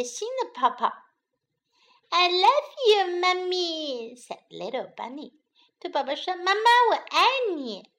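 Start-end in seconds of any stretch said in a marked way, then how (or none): 2.1-4.2 put-on high, affectionate child voice; small bunny's sweet declaration; little bunny. A narrator's reading. 6.4-7.9 put-on high, affectionate child voice; small bunny's sweet declaration; little bunny. A narrator's reading.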